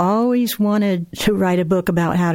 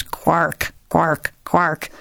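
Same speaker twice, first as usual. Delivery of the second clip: lightly voiced setting hen's warning squawk; a hint of a cross broody hen; deep, ugly hen; much slower than usual. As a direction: croak it out like a hen saying keep away. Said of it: "in a deep, ugly voice".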